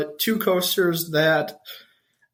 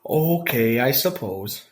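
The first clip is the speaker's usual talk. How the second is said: low, drawn out Wisconsin accent; a Wisconsin drawl on okay; 5 semitones lower, slower than usual. Real, not acted. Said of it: "Wisconsin accent thing".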